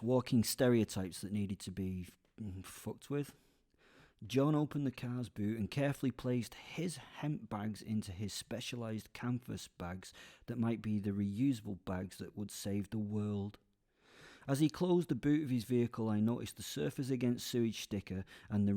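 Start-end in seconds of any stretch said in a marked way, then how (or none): none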